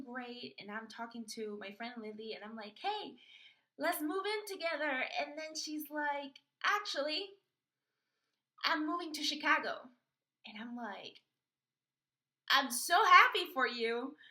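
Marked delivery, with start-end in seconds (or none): 2.8-4.9 high, bright-pitched eager ask; eager invitation. Retold or imitated. Asking friend Lily to move in. 6.6-9.9 higher, louder breezy announcement; lightly voiced friend. Lily's reply voiced. 12.5-14.2 much louder, slow overdone happy; loud mock cheer. Forced congratulation to friend.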